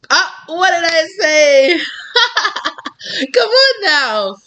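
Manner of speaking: high, loud, laughing come on now; excited shout. Real, not acted. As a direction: cry out in thrilled laughter, slowly. Thrilled by the cards.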